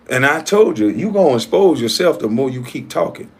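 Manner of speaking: ordinary talk, nothing marked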